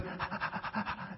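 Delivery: imitated rapid, breathy dog pants; anxious dog panting. Preacher mimicking his anxious dog's nonstop panting, quick nervous pants. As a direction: pant fast like a nervous dog. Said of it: "she panted ... twenty-four seven, this".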